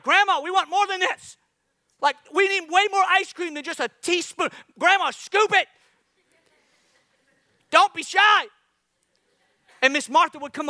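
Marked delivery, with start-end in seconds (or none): 0.0-8.5 put-on much higher, louder kids pleading for more; begging kids. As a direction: beg grandma loudly for a bigger scoop. Kids begging grandma for ice cream.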